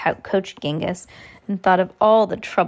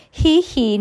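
higher tee-hee, cut short; giggling; 6 semitones higher, 4 dB louder than usual. A narrator's reading. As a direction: start a put-on giggle and stop. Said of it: "Nero stopped giggling".

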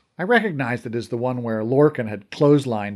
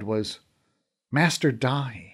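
ordinary delivery

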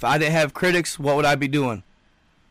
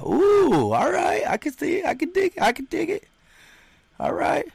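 much higher playful falsetto; 14 semitones higher than usual. Real, not acted. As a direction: jump up into a silly high voice. Playful reaction to a chat comment.

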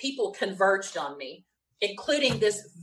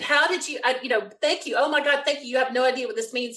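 higher-pitched, tumbling gratitude; retold gushing thanks; 3 semitones higher than usual. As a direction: gush thanks, picking up speed.

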